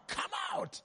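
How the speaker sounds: very high, strained exorcist's command; casting out a demon. Retold or imitated. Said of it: "they were casting out the demon".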